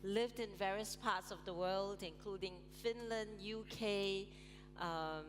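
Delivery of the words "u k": ordinary talk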